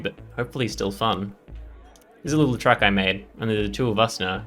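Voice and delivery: ordinary talk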